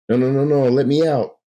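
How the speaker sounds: trapped and desperate to get out; panicked plea. Acted. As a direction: Bruce begging the doctor to free him. Bruce panics, begs release.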